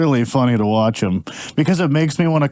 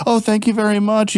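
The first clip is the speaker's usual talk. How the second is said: mock polite; 7 semitones higher than usual. Acts out needless politeness.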